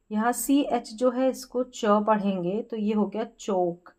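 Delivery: normal talking voice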